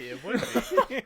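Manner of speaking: sly agreement. Retold or imitated. To his buddy about the easy fort loot, slow scheming agreement. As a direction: stretch out It would be as if plotting. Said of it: "And I was like".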